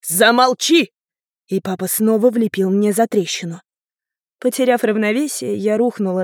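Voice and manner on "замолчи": loud, abrupt command to be silent; furious order. A narrator's reading. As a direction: cut them off angrily. Father cuts the girl off. (гневный окрик)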